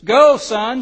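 high, loud anguished call; cried out. Acted. Mother calling to her son on the wagon, loud high cry. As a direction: send him off with all her heart. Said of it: "she cried".

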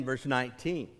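ordinary delivery

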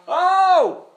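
loud, high, laughing protest; hammed-up flabbergasted cry; mock astonishment. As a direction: exclaim as if caught totally off guard. Acts offended, amazed believer addressing God.

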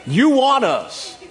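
loud, emphatic preacher's exhortation; fired-up preaching, hitting the key words hard; full-force preaching. Real, not acted.

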